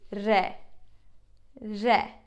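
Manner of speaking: "re" with a light Turkish R; "re", the R not too strong; soft Turkish R. Shown as a demonstration. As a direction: say "re" without a strong American R. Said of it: "it's not that strong R".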